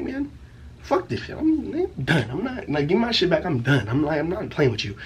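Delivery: high-pitched exasperated whine; fed-up retort. Retold or imitated. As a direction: act out a teen who has had enough, voice up high. Acted fed-up retort to mom.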